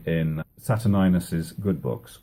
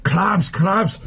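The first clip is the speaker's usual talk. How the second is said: scolding villain; 10 semitones higher, 3 dB louder than usual. Acted. Aaron breaks up the fight.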